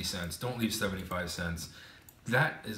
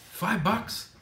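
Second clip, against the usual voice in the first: incredulous scoff; 10 semitones higher than usual. Real, not acted. Scoffing at a cheap tip.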